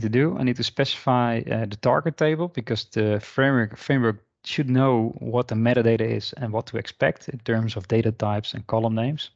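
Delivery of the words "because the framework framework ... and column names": normal talking voice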